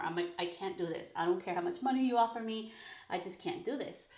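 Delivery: ordinary talk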